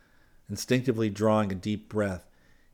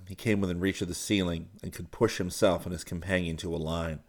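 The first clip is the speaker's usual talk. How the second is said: ordinary delivery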